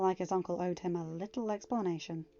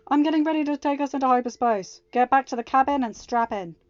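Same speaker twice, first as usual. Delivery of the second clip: calling out; 5 semitones higher, 9 dB louder than usual. A narrator's reading. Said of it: "Anakin called into the comm".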